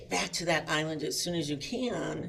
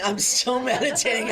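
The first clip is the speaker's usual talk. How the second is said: louder, higher, breaking into a laugh mid-line; laughing aloud; 7 semitones higher, 9 dB louder than usual. Real, not acted. To the audience about being towed, laughing loudly. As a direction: laugh at the irony of meditating. Laughing at her own calm.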